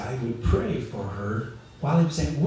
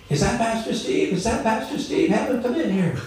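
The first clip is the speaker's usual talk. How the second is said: eager call from the next room, pitched much higher; a sick woman calling out for the pastor, voice raised high; calling out from bed; 10 semitones higher than usual. Retold or imitated. Dying woman calls from bedroom.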